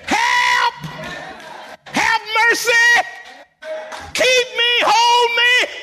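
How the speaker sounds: high, shouted pleas in bursts; urgent short prayer. Acted. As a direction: shout each plea straight to heaven. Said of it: "Cut to the chase and say".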